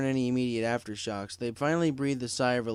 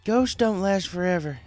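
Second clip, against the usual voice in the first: higher, louder flat assertion; stated firmly; 8 semitones higher, 6 dB louder than usual. Acted. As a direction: convince yourself too. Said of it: "Applejack stated".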